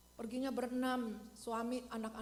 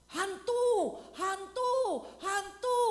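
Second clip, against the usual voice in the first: loud, high frightened yelling; terrified shout; 10 semitones higher, 11 dB louder, much slower than usual. Acted. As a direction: shriek it like you've seen a ghost. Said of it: "dikatain apa". (murid berteriak ketakutan, keras dan tinggi)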